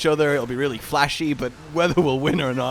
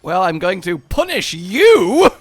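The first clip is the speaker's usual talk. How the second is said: playful battle threat; 3 semitones higher, 7 dB louder, slower than usual. Pretend.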